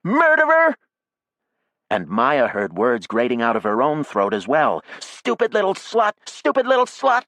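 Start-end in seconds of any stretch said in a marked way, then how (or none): 0.0-0.8 screaming much higher, louder; shout. A narrator's reading. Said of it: "who shouted". 5.0-7.2 much higher, out-of-breath screaming; gasping shriek. A narrator's reading. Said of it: "between gasps for air".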